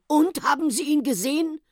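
asks, hanging on every word; eager question. Acted. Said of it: "fragte der Professor gespannt". (gespannt und neugierig)

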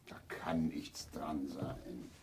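leise geflüstert, fast tonlos; flüstere es, damit keiner hört; whisper. Real, not acted. Flüsternd beim Fernseher basteln. (geflüstert, ohne Stimme)

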